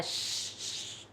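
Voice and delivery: श्श as a long voiceless hush; sh sound demo. Shown as a demonstration. Teacher to class, showing the श sound. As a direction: hiss out श्श with no voice. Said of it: "जैसे करते हैं श्श".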